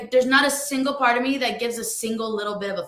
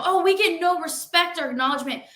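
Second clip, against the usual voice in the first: higher-pitched mock complaint; mocking imitation; 6 semitones higher than usual. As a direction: parody a self-pitying commenter. Mimics the commenter as self-pitying.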